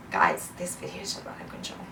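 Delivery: near-whisper with the voice dropped away; very soft, voiceless confession to viewers; hushed, overcome aside. Real, not acted. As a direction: say it under the breath, barely voiced. Overcome while trying to control herself.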